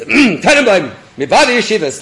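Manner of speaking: loud, high official declaration; official announcement. Acted. Rabbi announcing himself at the locked gate, official. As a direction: announce yourself importantly at the gate. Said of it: "like, you know, official".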